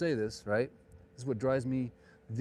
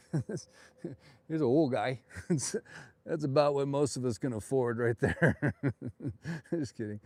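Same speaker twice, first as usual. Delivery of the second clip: amused, words broken up by giggles; laughing; 4 dB louder, slower than usual. Real, not acted. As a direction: talk through real laughter. Laughing at his own joke.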